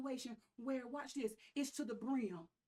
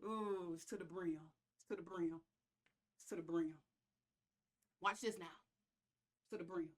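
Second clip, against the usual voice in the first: low, soft repeated murmur; quiet; 8 semitones lower, 6 dB quieter than usual. Real, not acted. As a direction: drop it down low and hushed. Preacher drops voice, repeats phrase.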